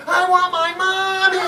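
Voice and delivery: put-on high, wailing little boy voice; crying child. Lost boy keeps crying for his mother, wailing. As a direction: whine it like a lost kid. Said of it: "He just cried".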